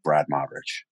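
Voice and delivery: low, soft name call from the stage; announcing his name. Retold or imitated. Narrator recalls the presenter calling his name, soft and low. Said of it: "And they were like".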